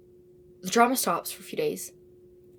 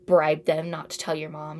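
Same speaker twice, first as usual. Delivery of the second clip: ordinary delivery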